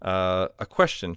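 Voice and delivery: ordinary talk, nothing marked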